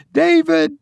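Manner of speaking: high, held calling-out; mother calling her lost son. A narrator's reading. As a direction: call high to someone far away. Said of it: "She raised her voice".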